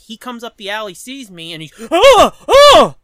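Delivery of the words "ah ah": imitated grunting, high and forceful; two explosive grunts; loud mock grunts. Junker accosting someone picking up cans, loud imitated grunting. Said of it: "Starts grunting at me".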